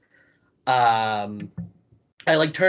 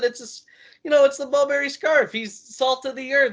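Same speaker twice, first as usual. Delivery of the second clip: much higher, fast nervous self-imitation; flustered explaining; 12 semitones higher than usual. Storyteller replays his own nervous reply to Janela, high and rushed. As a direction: rush out a sheepish defence of the scarf. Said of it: "I said".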